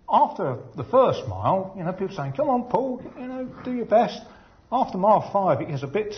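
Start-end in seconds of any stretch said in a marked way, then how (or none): 2.3-4.2 high-pitched mock encouragement; imitated spectators' cheering. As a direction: mimic well-wishers calling out, pitched well up. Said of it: "people then can shout out your name".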